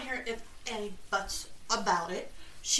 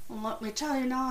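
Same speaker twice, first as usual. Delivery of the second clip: ordinary delivery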